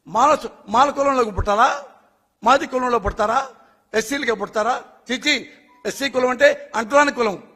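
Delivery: ordinary talk, nothing marked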